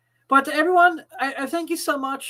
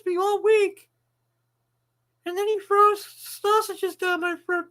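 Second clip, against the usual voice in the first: higher-pitched whiny pet voice complaining; host voices his dog griping about its owner, higher whiny pet voice; 7 semitones higher than usual. Pretend. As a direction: play the aggrieved dog, pitched up.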